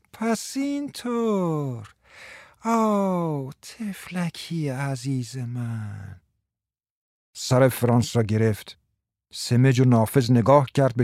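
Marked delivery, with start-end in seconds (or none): 0.1-6.2 slow drawn-out words, fading soft. Acted. Martha cooing at Frans, slow and drawn-out. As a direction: stretch every word lazily. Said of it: "با صدای کشداری گفت".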